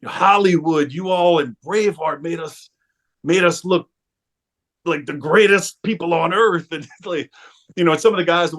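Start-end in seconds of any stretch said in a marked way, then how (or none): 0.0-6.7 put-on Scottish accent. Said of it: "I can't do a Scottish accent".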